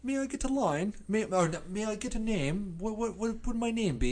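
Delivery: high-pitched mock British accent; hammed-up timid British plea for a part. Pop star begging the show for a line, in mock British. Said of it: "Is that your British accent?".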